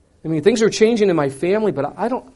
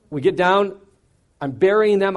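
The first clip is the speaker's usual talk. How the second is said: normal talking voice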